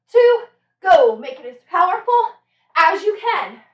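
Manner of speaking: loud urging coach calls; barking gym coach. Real, not acted. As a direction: push them for power. Coach starting jumps.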